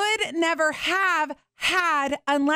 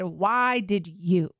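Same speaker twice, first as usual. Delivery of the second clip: slow accusing question; 5 semitones lower, slower than usual. Retold or imitated. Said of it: "pointed my finger at the Lord and said".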